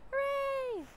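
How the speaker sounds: high-pitched acted cheer; townsfolk cheer. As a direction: cheer the hero in a squeaky crowd voice.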